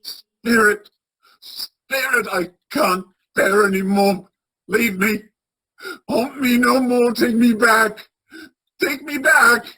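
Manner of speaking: loud, anguished begging that keeps climbing; desperate pleading. Acted. Scrooge begging the spirit to take him back, anguished cry. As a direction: cry out to be taken home, breaking with distress.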